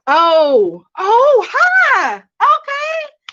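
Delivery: high, loud squealed hello; light up at a familiar face in chat; delighted surprise. Real, not acted. Streamer greets a returning viewer, squealing with surprise.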